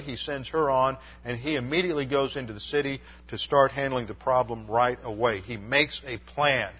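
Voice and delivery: usual speaking voice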